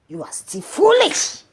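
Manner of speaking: usual speaking voice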